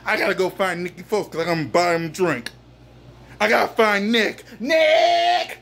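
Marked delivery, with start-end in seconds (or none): 4.6-5.6 high, drawn-out holler; calling out. Real, not acted. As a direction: shout for someone you're looking for. Calling out to find Nick.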